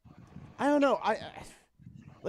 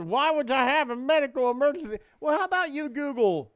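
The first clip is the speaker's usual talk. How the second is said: mocking caller; 10 semitones higher, 6 dB louder than usual. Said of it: "He goes".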